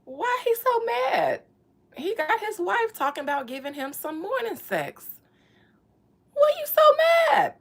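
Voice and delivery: squeaky baffled why so mad; incredulous. Real, not acted. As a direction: stretch the question up high in amazement. Mocking the grumpy husband.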